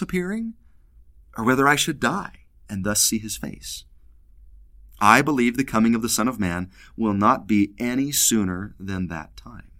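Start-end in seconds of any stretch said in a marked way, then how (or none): none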